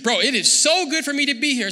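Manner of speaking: loud, high, thrilled gushing; starstruck excitement. Acted. Peter starstruck beside Jesus, thrilled and loud. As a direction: gush like a fan meeting celebrities.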